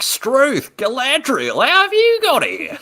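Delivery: high, loud, hammed-up Australian accent; Aussie orc. Orc finding Galadriel, exaggerated Australian accent. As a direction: shriek it as a startled Australian orc. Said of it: "Aussie accent orcs rather than Cockney".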